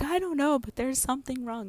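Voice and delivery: high, pleading bewilderment; retold distress. Wife answering husband's what is wrong, high and distressed. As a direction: answer high and helpless, replaying the panic.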